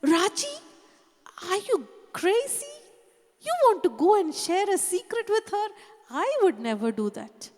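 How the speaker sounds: high, loud mocking rebuke of a student; scornful professor. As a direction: scoff at the idea, as the nun did. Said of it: "she said".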